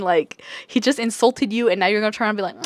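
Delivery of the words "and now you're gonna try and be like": ordinary delivery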